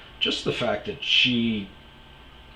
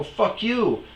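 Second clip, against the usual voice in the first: higher, louder fired-back insult; mock retort; 12 semitones higher, 4 dB louder than usual. To the stranger, firing the insult back in mock anger. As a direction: toss it right back. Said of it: "I go".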